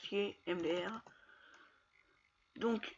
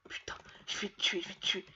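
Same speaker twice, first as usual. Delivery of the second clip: whisper; 3 dB quieter than usual. Real, not acted. Stalking an enemy player.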